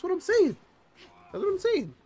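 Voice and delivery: cartoonish high agreement; mock squeaky voice. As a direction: squeak it playfully. Mock high voice reacting to chat.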